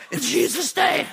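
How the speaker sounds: high, strained mock shout; desperate high-pitched rebuke, acted out; choked panicked cry. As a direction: cry it out as if being strangled. Crying out while being choked.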